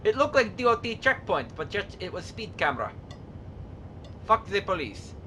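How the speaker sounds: mock Soviet accent with articles dropped; put-on Soviet-style accent. Gamer narrating his truck driving to viewers, mock Soviet accent. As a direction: play the deadpan Soviet trucker, accent throughout. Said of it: "stop doing that accent now".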